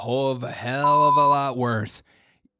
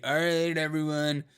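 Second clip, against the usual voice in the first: mock party host. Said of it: "just be like".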